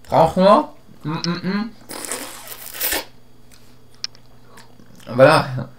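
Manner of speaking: talking around candy stick; mumbling while sucking candy; candy in mouth. Real, not acted. Seen 3: Pink candy stick licked at 1.9, held in mouth from 2.2.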